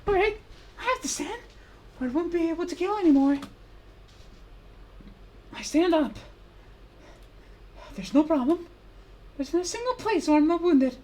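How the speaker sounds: high and loud, forcing it out; straining shout. Acted. Wounded fighter forcing himself to stand and fight. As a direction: push through the pain at full volume.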